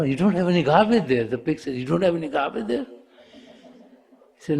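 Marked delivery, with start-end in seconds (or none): 0.0-1.2 quick, higher-pitched correction; insistent denial. Acted. Disciple corrects the pig's garbage hope. 1.8-2.9 quick, high, rising question; disbelieving echo question. Acted. Pig echoes the disciple in disbelief.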